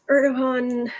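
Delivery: usual speaking voice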